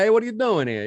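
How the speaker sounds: high-pitched mock New York wise guy. Shown as a demonstration. Co-host showing their upset self, New York wise-guy accent. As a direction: challenge them in a hammed-up Bronx accent. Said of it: "Like a wise guy".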